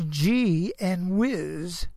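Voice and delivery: "G" and "whiz" said slowly and apart; very slow, deliberate separate words. Shown as a demonstration. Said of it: "two separate words".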